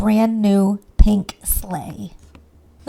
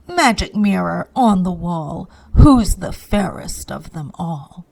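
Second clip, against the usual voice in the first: slow, lightly voiced vain queen, lower; queen asking her mirror; 4 semitones lower than usual. A narrator's reading. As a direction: savour the rhyme like a vain queen.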